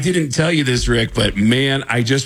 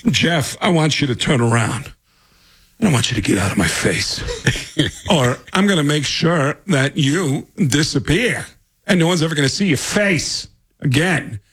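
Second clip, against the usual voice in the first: Christopher Walken threat; 3 semitones higher than usual. Retold or imitated. Bloodied Walken in the story threatening the pushy extra. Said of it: "Christopher Walken is wiping blood".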